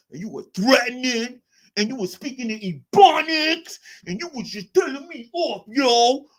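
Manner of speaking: high hammed-up mockery of a ranting chatter; mock street talk. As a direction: lampoon his threats as a ranting tough guy.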